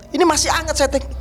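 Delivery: high, urgent telling; bursting with amazement; excited. Real, not acted. Guest telling the host the handshake still felt warm, excited and high.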